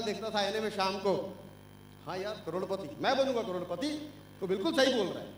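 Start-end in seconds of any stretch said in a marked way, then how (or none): 2.1-3.9 softer, lower, murmured to his own reflection; hushed, private promise to himself; soft self-talk. Retold or imitated. Young man at the mirror telling himself, softly murmuring.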